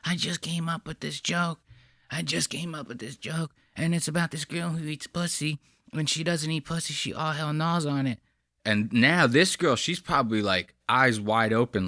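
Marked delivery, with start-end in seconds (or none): 0.0-8.2 hushed, sly imitation of him; creepy mocking imitation. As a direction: quietly mimic the creep telling his joke. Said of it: "the way he's telling her".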